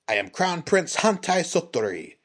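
loud, high, proud declaration. A narrator's reading. Prince introduces himself to three ronin, proudly. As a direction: announce your title with pride. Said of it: "the prince said proudly".

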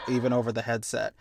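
ordinary talk

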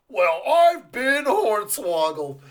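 mock duped believer.

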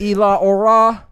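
loud, slow, high hammed-up Spanish; mock Spanish. Joking about Spanish class, loud, slow and high. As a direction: belt it like a goofy class chant.

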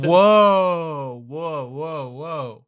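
loud gasp of surprise fading off; startled, then trailing away; surprised whoa. Real, not acted. Reacting to the bigger bag.

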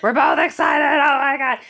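high, loud burst of excitement; real excited yelling; excited shout. Real, not acted. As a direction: squeal it out, thrilled. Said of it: "Do you hear the excitement in her voice".

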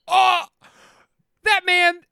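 high, loud panicked cry; hammed-up horror at being tricked; shocked realization. Telling his wife the salesman tricked him, loud high alarm.

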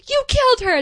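high, loud accusing shout; mock accusing yell. Acting out the twins confronting their mother, loud mock yell. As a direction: fling the accusation hard. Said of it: "freaky twin speak".

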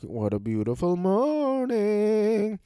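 high, long-drawn singsong delight; hammed-up morning bliss; happy Grinch. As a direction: stretch the last word out high and gleeful. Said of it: "he's happy in the morning".

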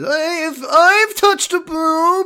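very high, slow creepy nerd voice; nasal smug neckbeard. Pretend.

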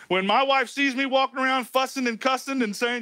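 usual speaking voice

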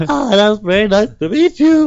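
high, squeaky awkward pleasantry; mock nervous squeak; voice cracking. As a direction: mimic a cracking voice when starstruck. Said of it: "my voice is still cracking".

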